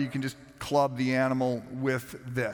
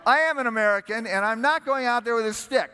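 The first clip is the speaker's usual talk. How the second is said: 1 defiant retort; 9 semitones higher, 4 dB louder, faster than usual. Retold or imitated. Retold standoff with the guide.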